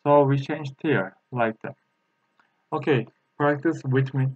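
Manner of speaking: normal talking voice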